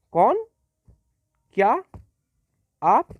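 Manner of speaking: each word thrown up high in surprise; surprised exclamations. Shown as a demonstration. Teacher demonstrates surprise words to students, with rising astonished tone. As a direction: cry out कौन क्या आप like a startled question. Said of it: "तो surprise element है".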